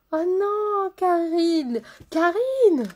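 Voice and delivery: loud, high playful protest; mock scolding. Real, not acted. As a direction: tease her name out loud. Teasing a viewer by name.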